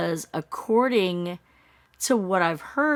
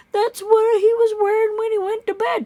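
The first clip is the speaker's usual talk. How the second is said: sarcastic; 7 dB louder than usual. Real, not acted. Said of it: "that's convenient".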